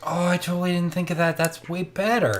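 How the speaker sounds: a light chef voice; eager chef. Acted. Voicing the chef's eager reply.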